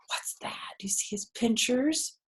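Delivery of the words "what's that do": a gasp, then a whispered question; hushed surprise. Real, not acted. Surprised at picture for children.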